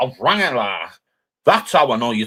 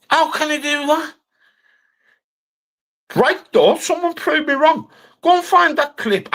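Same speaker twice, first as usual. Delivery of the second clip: high mock voice. Mocking high-pitched voice rant.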